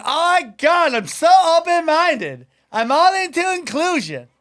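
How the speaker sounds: high, gushing parody of a mother; hammed-up high woman's voice.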